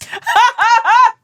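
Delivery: a burst of high-pitched laughter. Real, not acted. Hosts reading a fan letter, high burst of laughter. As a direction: laugh out loud, high and bright.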